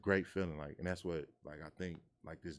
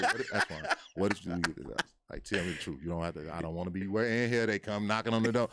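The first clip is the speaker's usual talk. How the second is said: loud, laughing mock mom grilling her son; suspicious mom; 2 semitones higher, 9 dB louder than usual. Mom grilling her son about the house money, loud and laughing. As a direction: play the worried mom demanding the truth. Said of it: "she gonna say".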